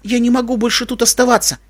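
high-pitched, desperate plea; imploring young mare; pleading. A narrator's reading. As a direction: beg as if at the end of endurance. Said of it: "умоляюще попросила она".

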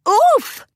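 high sharp oof as she falls; oof of surprise. A narrator's reading. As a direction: blurt out a startled oof. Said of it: "Twilight exclaimed as she tumbled".

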